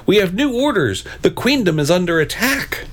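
high-pitched announcement of alarm; theatrical herald raising the alarm; urgent cry. A narrator's reading. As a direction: cry out the grave news. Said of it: "cried the Blue Knight".

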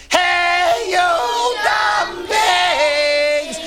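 huge high-pitched, drawn-out holler; full yell. A narrator's reading. Girl yells at pigs from the opened door, full holler. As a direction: yell it at the top of your voice, stretching each word. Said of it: "opened it up all the way and yelled".

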